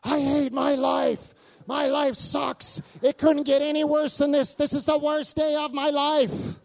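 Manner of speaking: high, loud, drawn-out wail of despair; hammed-up shouting tantrum, every word stretched; mock teenage meltdown. Son's meltdown at home, acted out.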